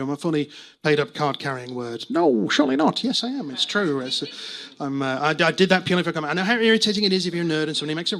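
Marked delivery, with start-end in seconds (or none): none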